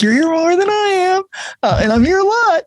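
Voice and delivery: very high-pitched put-on voice; mock cheery teasing. Staff teasing a regular ER patient, mock cheery. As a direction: keep it high and over-friendly, imitating small talk.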